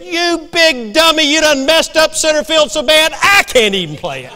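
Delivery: loud, high mock-furious yell at the player; angry yell. Manager yelling at his lousy center fielder, furious shout. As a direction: bellow it like a fed-up coach.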